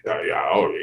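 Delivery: low, put-on voice of a shy young man; changed affected voice. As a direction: imitate the boy fumbling for fancy words. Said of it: "su voz la cambiaba". (imitando la voz afectada del muchacho)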